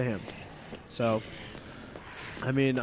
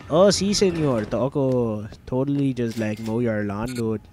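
hammed-up Mexican accent; mock Mexican accent. Player joking about tacos tonight, mock Mexican accent. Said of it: "That was so racist".

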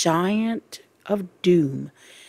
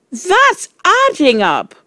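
put-on high, loud boy's protest; crying out; 13 semitones higher, 7 dB louder than usual. A narrator's reading. Wilfred objecting to the Trunchbull's sum, crying out. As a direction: cry out in protest. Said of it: "Wilfred cried".

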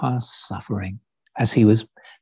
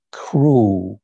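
ordinary talk, nothing marked

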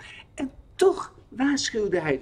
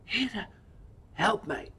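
quietly praying, a little softer; hushed, urgent little prayer; 3 semitones higher, 8 dB quieter than usual. A narrator's reading. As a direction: breathe a small plea to God. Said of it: "Zachtjes bidt hij".

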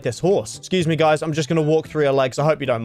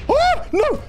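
very high sudden cry; real dread at the explosion; terrified squeal. Real, not acted. Final panicked cry.

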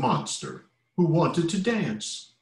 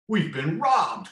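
higher, slow rooster alarm; crowing rooster; 7 semitones higher, 4 dB louder, slower than usual. A narrator's reading. Little rooster discovering eggs gone, crowing alarm. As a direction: crow the alarm. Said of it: "crowed the little rooster".

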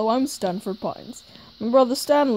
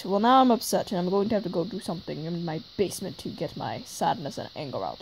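normal talking voice

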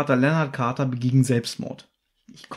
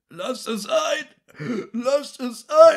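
langsam gestöhnt, höher werdend, am Ende laut; stöhnender Betrunkener; 9 semitones higher, much slower than usual. Retold or imitated. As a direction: lallend und jammernd wie ein betrunkener Anrufer. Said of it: "der stöhnt so ein bisschen rum". (fremder Anrufer stöhnt ins Telefon, langsam und klagend)

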